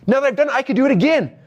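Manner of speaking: high, thrilled self-quote; excited. Retold or imitated. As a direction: burst out like you just won. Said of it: "I'm like".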